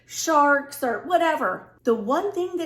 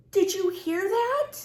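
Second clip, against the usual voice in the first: frightened whisper-question; 8 semitones higher than usual. Retold or imitated. Wakes husband, frightened by noise.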